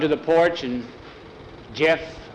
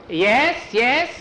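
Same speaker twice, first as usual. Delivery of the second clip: higher put-on voice of the cook; cook character voice; 8 semitones higher than usual. Cook hurrying in to answer the boss, higher put-on voice. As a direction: answer eagerly in the cook's voice. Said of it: "our Chinese cook".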